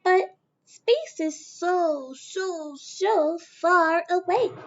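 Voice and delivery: high, slow and drawn out; wistful little Mae. Acted. Young Mae sighing to her dad that space is far, high and slow. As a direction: stretch each so, longing.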